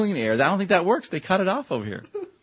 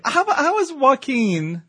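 mock dramatic; 11 semitones higher, much slower than usual. Mock dramatic question to cohost.

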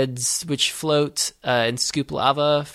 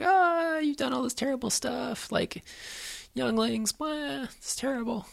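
much higher, softer mock-tearful Padme; 12 semitones higher, 7 dB quieter than usual. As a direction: squeak it out like a weepy heroine. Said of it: "and she's like".

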